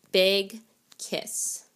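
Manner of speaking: plain reading voice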